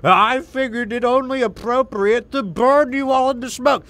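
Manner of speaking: loud, high, slow gloating villain; villain taunt. Retold or imitated. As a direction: sneer it like a cartoon villain. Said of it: "a Inner Sphere mech warrior named Helmar Valasek says".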